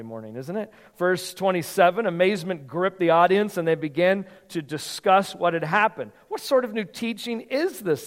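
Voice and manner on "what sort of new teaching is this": higher, eager amazed question; excited question. Acted. Crowd asking one another in amazement, excited and higher. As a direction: ask it like the crowd can't believe it. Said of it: "asked excitedly".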